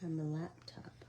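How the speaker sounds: soft unvoiced whisper, a bit fast; barely voiced, apologetic half-whisper; whispery morning voice. Real, not acted.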